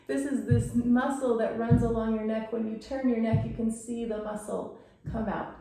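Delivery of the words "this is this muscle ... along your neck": ordinary delivery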